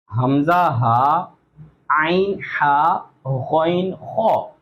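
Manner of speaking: हमजा हा, आइन हा, गौन खो drawn out from the throat; slow throat letters. Shown as a demonstration. Teacher sounds out throat letters for students to memorize. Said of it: "ये याद रखें".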